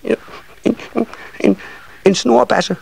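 tiny, high, halting voice; shy smallest child. A narrator's reading. Smallest nisse finally naming his wish, shy high stammer. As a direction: get it out bit by bit, high and bashful. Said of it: "sagde den lille nisseunge til sidst".

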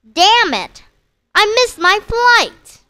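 furious shout at the departing plane; drunken yell. Acted. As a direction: yell it after the plane that's leaving. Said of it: "You yell".

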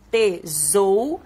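"Tesoura" slowly, syllable by syllable; stretched syllables with a buzzing middle Z; sounding out. Shown as a demonstration. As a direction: break "Tesoura" into te, zou, ra. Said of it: "O som das letras".

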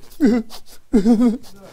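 high, loud mock wail of a child; a father mimicking his son crying at night; imitated child wailing. Child crying across the hall.

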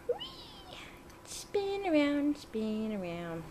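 high whispered whee, slow spin; slow playful whisper. Real, not acted. Playing with bird.

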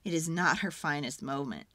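ordinary delivery, nothing marked

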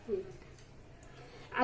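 slow hushed whisper. Real, not acted. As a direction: whisper so the cats aren't disturbed. Whispering while cats eat. (हळूच कुजबुजत)

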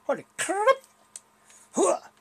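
high startled exclaim; surprised. Real, not acted. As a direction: yelp it in surprise. Surprise in game.

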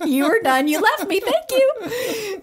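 high, mock-wounded teasing. Real, not acted. Wife teasing husband for leaving her alone, mock hurt. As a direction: rib him playfully for walking out.

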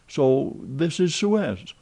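high, slow, marvelling question; dreamy amazement; wondering. Acted. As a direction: savour it in disbelief. Passepartout marvels at travel.

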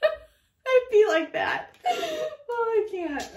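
very high, slow, crying with laughter; can barely get it out laughing; real teary laughter; laughing to tears. Real, not acted.

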